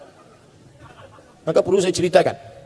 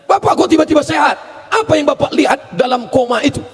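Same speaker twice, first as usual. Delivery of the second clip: amazed doctor; 7 semitones higher than usual. Acted. Doctor asking amazed patient.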